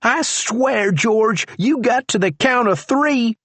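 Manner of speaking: high, threatening warning; deputy threatening the prisoner; Charlie's voice. A narrator's reading.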